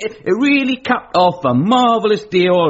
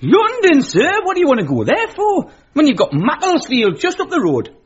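surprised; 8 semitones higher than usual. Acted. Ned astonished at London trip.

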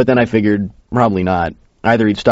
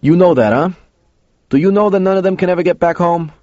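hard challenge; 9 semitones higher than usual. A narrator's reading. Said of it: "looked at me hard and said".

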